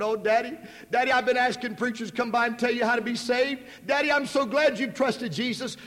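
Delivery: put-on a little girl overjoyed for her daddy; joyful girl. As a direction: daughter rejoicing over her saved father. Overjoyed daughter.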